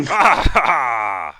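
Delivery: loud, high belly laugh; dwarf guffawing at his own joke; booming laugh. Acted. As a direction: roar with laughter.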